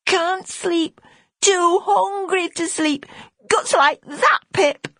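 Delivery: put-on high child voice, slow on too hungry; a little lad who cannot sleep from hunger; hungry small boy, whining.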